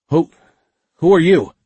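stammered, high and loud; frightened, tripping over words; startled stammer. A narrator's reading. Startled ensign at gunpoint.

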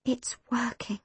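very soft, hushed tone; barely above a whisper. A narrator's reading. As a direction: whisper it in quiet wonder. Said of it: "Dad whispered into the stillness".

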